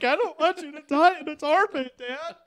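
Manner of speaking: put-on high, loud whiny kid plea; mock child's plea. Hugging his dad at night, a high loud mock-childish plea. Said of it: "I'm like".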